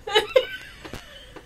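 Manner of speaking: breathless high wheezing laugh; high squealing laugh. Real, not acted. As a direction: lose it completely. Helpless laughter after the story.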